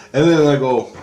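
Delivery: normal talking voice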